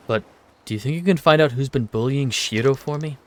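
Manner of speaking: usual speaking voice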